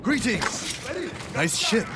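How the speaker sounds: high, cocky mock-friendly hail; mock greeting. Taunting the Mongol crew before attacking, mock friendly. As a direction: greet the enemy like an old friend before the fight.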